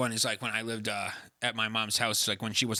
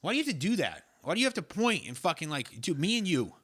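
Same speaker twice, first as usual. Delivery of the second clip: high-pitched, real exasperation at being interrupted; exasperated complaint; 8 semitones higher, 4 dB louder than usual. Real, not acted. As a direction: plead indignantly, pitch swooping way up. Real bickering after being interrupted.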